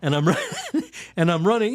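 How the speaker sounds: high, loud words broken by laughter; real laughing. Real, not acted. Storyteller resumes the hippo chase, still laughing. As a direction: laugh through the retelling.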